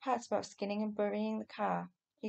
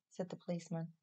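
plain reading voice

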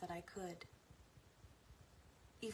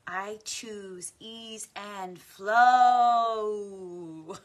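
very slow and loud, stretched out; drawn-out loud affirmation; 2 semitones higher, 15 dB louder, much slower than usual. Acted. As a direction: sing it out slowly and loudly. Repeated affirmation, three ways.

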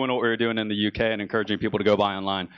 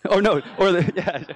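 laughing; 7 semitones higher than usual. Real, not acted. Laughing at own story.

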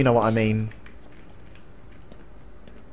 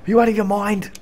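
toned-down put-on voice; a hint of the cartoon outrage; milder mock disbelief; 6 semitones higher, faster than usual. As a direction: say it again, less outraged. Second, milder take.

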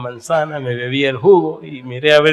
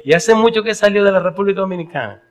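curious lady; 9 semitones higher than usual. Retold or imitated. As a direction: press him like a nosy lady, pitched up. (señora preguntona, voz más aguda)

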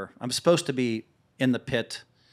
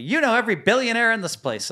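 higher, louder mock ribbing; teasing; 11 semitones higher, 6 dB louder than usual. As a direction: needle him playfully. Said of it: "their way of making fun of me".

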